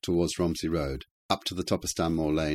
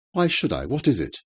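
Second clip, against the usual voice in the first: high, loud, swooping woman's retort; indignant challenge; 6 semitones higher, 4 dB louder than usual. A narrator's reading. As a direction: throw the question back, affronted. Hostile widow challenges visitor at door.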